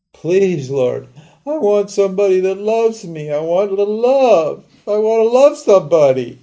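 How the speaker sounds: high, imploring mock prayer; hammed-up lonely devotee praying; pleading prayer. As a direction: beg the Lord for love in a lifted, pleading voice.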